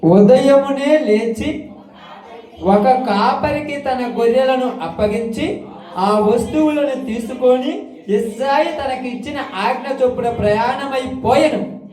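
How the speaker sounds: slow, lifted chant of the verses; scripture reading. Acted. Preacher reading scripture to the congregation, slow and chanted. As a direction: read the verses like a sermon recitation.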